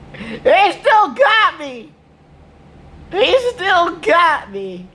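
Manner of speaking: high, slow mock wail of a held man; mock whining captive. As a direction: drag it out high like a pitiful captive. Voicing the man held in a corner.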